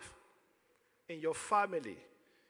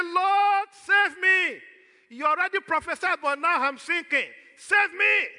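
high, loud shout for rescue; Peter's desperate cry; 9 semitones higher, 8 dB louder than usual. Acted. Sinking Peter cries to Jesus for rescue, in a high desperate shout. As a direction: cry out to be saved from drowning. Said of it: "waiting for him to cry".

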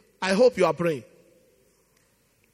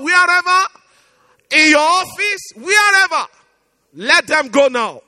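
preaching at full force; 3 semitones higher, 7 dB louder than usual. Real, not acted. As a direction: pour it out over the congregation.

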